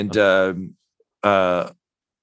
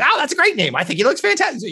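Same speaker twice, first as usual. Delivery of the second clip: much higher, gushing praise; gushing character voice; faster than usual. Acted. A companion praising the baby's name, gushing and very high. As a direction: coo it way up high.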